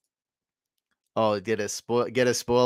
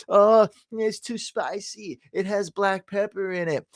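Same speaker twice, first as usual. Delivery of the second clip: mock the picky complainers; mock whiny complainer; 10 semitones higher than usual. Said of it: "They're complaining".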